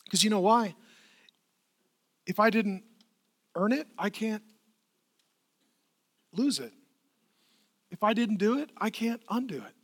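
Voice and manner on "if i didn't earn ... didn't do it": normal talking voice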